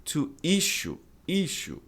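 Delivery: TO ISSUE, front-stressed; correct pronunciation of issue. Shown as a demonstration. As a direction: ISSUE as "ISH-oo", same for the verb. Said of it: "mesma pronúncia".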